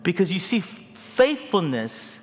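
loud, high rising sermon climax; fervent pulpit crescendo; preaching at full force. Acted. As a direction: build to a shouting preacher's peak.

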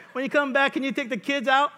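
high sweet request; mock wife. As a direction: put on a sweet wife's ask.

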